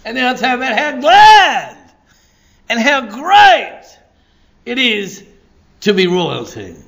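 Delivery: high and loud, swelling on the key words; exultant. Real, not acted. Preacher promises to tell about gladness, exultant. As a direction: let GLAD and GREAT soar out.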